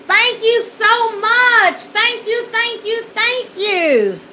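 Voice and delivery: real tears of gratitude spilling out; crying thanks. Real, not acted. Thanking the friend who fixed her lighting, crying.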